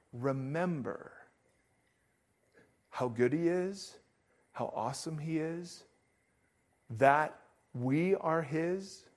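gently reminding the church of God's goodness; tender. Real, not acted. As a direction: drop the volume and linger on each phrase. Pastor urging people to remember.

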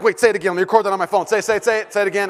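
loud, high, insistent repetitions; eager urging. Retold or imitated. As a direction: plead excitedly for a repeat. Dad eagerly asks son to repeat.

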